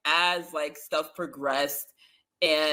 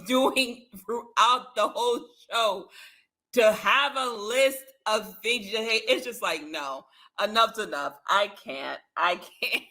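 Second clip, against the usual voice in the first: normal talking voice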